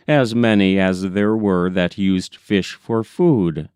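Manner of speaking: ordinary delivery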